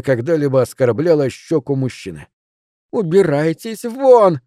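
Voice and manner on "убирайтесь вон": slow, high cry of Get out; furious woman shouting her suitor away. Acted. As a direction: fling вон out high and drawn. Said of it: "закричала она".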